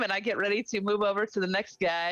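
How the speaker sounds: normal talking voice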